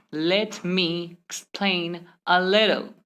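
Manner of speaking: very slow, "Let" and "me" apart; one separate word at a time. Shown as a demonstration. Teacher repeating the slow example, word-by-word. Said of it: "Instead of saying".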